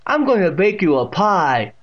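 high, loud deaf great-aunt's voice; Catherine. A narrator's reading. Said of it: "with that distinct pronunciation".

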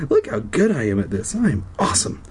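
usual speaking voice

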